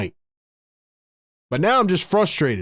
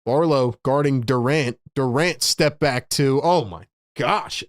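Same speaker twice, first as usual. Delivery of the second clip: usual speaking voice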